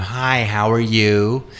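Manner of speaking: slow, put-on American accent; generic American accent. Shown as a demonstration. British guest tries his American accent, generic American. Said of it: "that sort of generic kind of".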